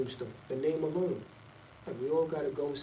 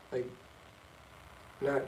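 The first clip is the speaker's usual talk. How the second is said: usual speaking voice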